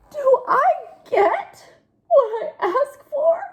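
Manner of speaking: high, slow mock wail; wailing pigeon. Pigeon wails about never getting things, high and slow. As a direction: whimper it out.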